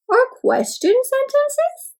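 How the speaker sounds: very high rising question on "question sentences"; "question sentences" in a high, wondering lilt. Shown as a demonstration. Demonstrating question sentence type.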